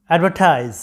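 অ্যাডভার্টাইজ as ad-ver-tize, puffed t, falling; correct pronunciation. Shown as a demonstration. Teacher corrects students' word, correct pronunciation. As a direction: model অ্যাডভার্টাইজ English-style. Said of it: "উচ্চারণটা হচ্ছে".

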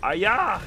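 high, loud "aya"; sharp loud video-game hit shout; mock battle cry. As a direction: yell a playful attack cry. Battle cry while attacking in game.

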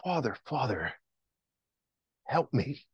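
hushed plea to the Father; soft, simple prayer for help; softly crying out to God. Acted. Believer crying to the Father in trouble, softly pleading. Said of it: "and we cry".

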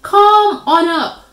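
high, loud, slow call; drawn-out beckoning shout. A narrator's reading. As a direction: holler an invitation up to the sky. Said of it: "Eagle called".

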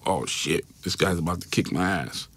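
low, soft inner-thought voice; quiet dread. Retold or imitated. Thinking to himself as detective steps closer, quiet dread.

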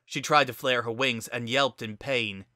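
usual speaking voice

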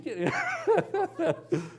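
a real high laugh breaking out; high burst of laughter. Real, not acted. Laughs at own joke.

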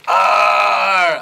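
loud puppet roar; angry dinosaur roar. Acted. Puppet dinosaur roaring at creatures, loud angry roar. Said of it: "a very angry dinosaur".

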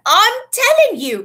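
high, loud, slow insisting; insistent shout. A narrator's reading. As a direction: insist it, cut off. Ron insists again.

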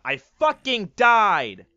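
yelled out loud in frustration; furious shout. Real, not acted. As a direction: bellow it after dying. Killed suddenly in game.

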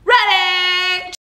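high, upbeat workout calls; fitness coach. Acted. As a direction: pump the class up. Leads workout round.

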